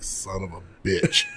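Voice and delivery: muttered curse. Retold or imitated. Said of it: "And I'm like".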